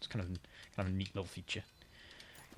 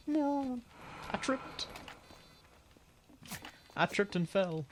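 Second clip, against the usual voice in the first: high whine; 11 semitones higher, 5 dB quieter, much slower than usual. Real, not acted. Game character tripped.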